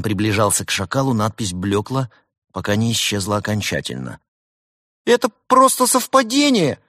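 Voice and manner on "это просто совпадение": very high, loud protest; angry little jackal pilot; angry squawk. A narrator's reading. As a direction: insist crossly in a squeaky voice. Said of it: "сердился пилот".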